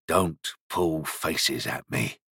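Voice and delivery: soft, slow and low, quietly stern; a gentle but firm warning; gently but sternly. A narrator's reading. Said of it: "he said to me gently but sternly".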